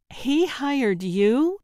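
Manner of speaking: he hired YOU?, drawn out and rising in shock; "He hired you?" stretched slowly, pitch climbing; incredulous. Acted. Samantha echoes in disbelief.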